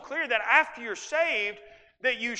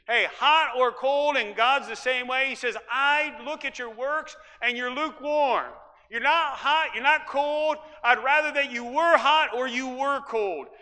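louder, higher pulpit delivery; preaching; 4 semitones higher, 5 dB louder than usual. Acted. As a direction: press the words on the congregation. Preacher voicing God's words.

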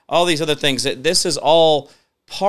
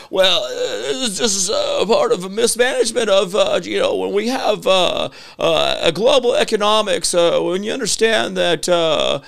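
high-pitched, hesitant politician imitation; Bill Clinton; 7 semitones higher, 4 dB louder than usual. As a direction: waffle through it in a high put-on voice. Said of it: "this is the worst Bill Clinton imitation".

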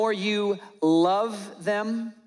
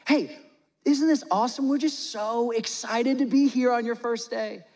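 higher-pitched, encouraging parent voice; acted sweet reassurance, pitched up; doting parent; 4 semitones higher than usual. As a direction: gush supportively to your nervous daughter. Acts parent comforting daughter waitress.

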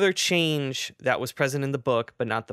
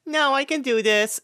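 put-on high, determined little kid voice; little girl voice; 13 semitones higher, 5 dB louder than usual. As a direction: squeak it like a proud toddler. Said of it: "She's like".

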